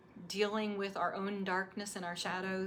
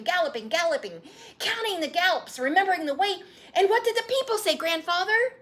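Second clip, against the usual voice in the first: louder, higher, racing narration; breathless excited boy, louder and higher; 9 semitones higher, 11 dB louder than usual. Acted. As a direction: build the thrill of the gallop. Boy excitedly retells race.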